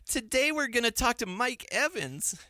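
put-on high, innocent kid voice; a naive, childlike radio character. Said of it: "the voice that we ended up settling on".

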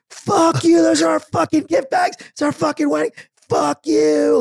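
screaming with rage, full force; furious shout. Retold or imitated. Yelling at honking drivers.

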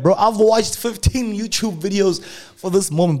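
squeaky excited inner thoughts; hyped inner voice. Retold or imitated. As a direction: pump yourself up inside your head. Inner hype voice, high pitch.

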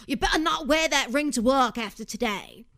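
lightly voiced impression of a man mid-meltdown; mock scolding in a put-on voice; James. Host imitates a fiancé fussing about a ring, mock meltdown. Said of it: "This is my James impression".

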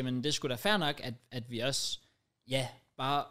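normal talking voice

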